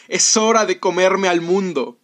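loud, high, drawn-out shout; fearful grinning shout. A narrator's reading. Jeremías hurries into his clothes and shouts, scared but grinning. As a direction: cry out big through a scared smile. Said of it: "con una sonrisa de miedo gritó".

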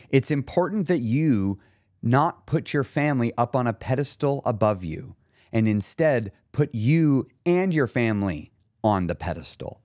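ordinary talk

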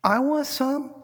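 put-on high kid voice demanding; a three-year-old insisting he wants it; little brother wanting. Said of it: "and he goes".

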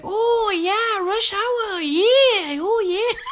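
excited, squealing high mimicry; old lady impression. Old lady confirming Rush Hour was filmed there, squealing impression. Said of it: "And she's like".

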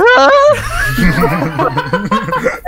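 high shrieking laugh; loud burst of laughter. Real, not acted. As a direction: howl with laughter.

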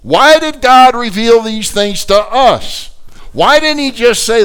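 loud, high, slow preacher's call; fiery preaching. Acted. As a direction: thunder the question from the pulpit. Preaching opening question.

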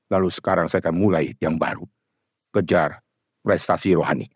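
ordinary talk, nothing marked

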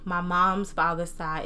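ordinary delivery

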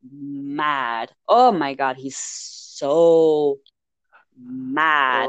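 "mad" and "so" stretched long and low; drawn-out emphasis. Real, not acted. Recapping Jacob's reaction, "mad" drawn out heavily. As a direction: hold "mad" out for dramatic weight. Stresses how angry Jacob is.

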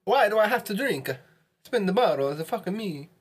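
high-pitched mock Italian accent. Player grumbling about having to drink, in a mock Italian accent. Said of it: "Don't forget Italian accent".

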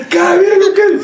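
high, overexcited mock exclamation; re-enacted excited cry. Player recalling himself in midfield, high excited exclamation. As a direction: pitch it high, reliving the disbelief.

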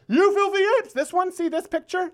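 hammed-up movie quote; movie villain. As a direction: sneer like the ape movie villain. Quoting the movie line.

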